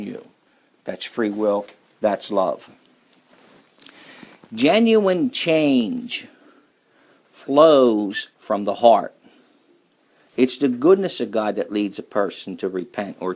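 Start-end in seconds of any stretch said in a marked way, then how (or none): 4.5-9.1 very slow, raised and louder, each word weighed; slow emphatic preaching. Acted. Preacher to congregation on true change, slow and weighty. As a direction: lay it down slowly and heavily.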